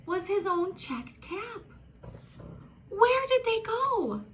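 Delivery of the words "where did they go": high, drawn-out "Where did they go?"; high, stretched-out storybook dismay; dismayed question. A narrator's reading. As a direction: wail it slowly, high-pitched, as a puzzled storybook character. Peddler finds his caps missing.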